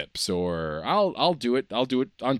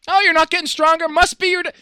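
high, loud nagging know-it-all voice; mocking know-it-all; 5 dB louder than usual. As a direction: lecture them in a shrill mocking voice. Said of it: "people go".

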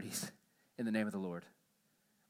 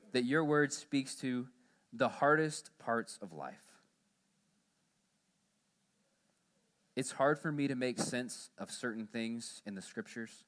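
prayer. Acted. Pastor leads closing prayer.

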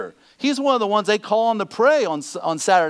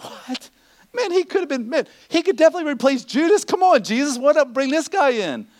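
crowd's surprised protest; 9 semitones higher than usual. Pretend. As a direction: voice the stunned crowd, pitch jumping up. Everybody's like, crowd reacting.

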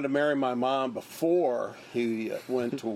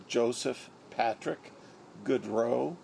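normal talking voice

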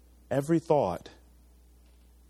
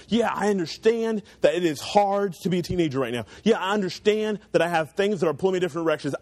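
raised, driving sermon voice; higher and pushing, full preacher mode; preaching; 5 semitones higher than usual. Acted. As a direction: preach it with building conviction.

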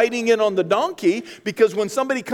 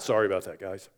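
offhand apology; 12 semitones lower, 7 dB quieter, faster than usual. Retold or imitated. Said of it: "Sorry about wrecking a three million dollar car".

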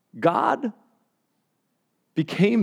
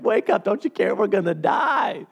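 higher, slowing at the end in mock fear; a lightly acted, pitched-up storm panic; 5 semitones higher than usual. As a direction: play the panicked disciples waking Jesus.

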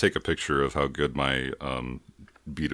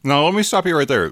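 high, loud cut-in; loud interruption; 11 semitones higher, 7 dB louder than usual. Real, not acted. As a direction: stop him mid-sentence, loudly. Interrupting co-host loudly.

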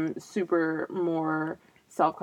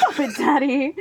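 raised, louder mock child voice; playful kid impression, pitched up; imitating little son; 7 semitones higher, 4 dB louder than usual. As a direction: do a small boy scolding his dad. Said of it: "He was like".

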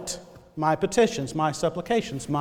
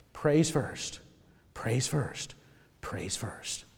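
each repeat softer and lower; repeating it softer each time; fading refrain; 8 semitones lower, 10 dB quieter, much slower than usual. Real, not acted. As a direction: let the refrain sink away.

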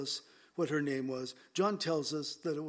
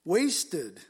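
Wasted said louder with a falling tune; stress on Wasted; 6 dB louder than usual. Shown as a demonstration. Preacher to his congregation, hitting Wasted louder. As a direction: lean hard on Wasted. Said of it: "I'm emphasizing that for a reason".

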